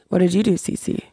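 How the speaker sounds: normal talking voice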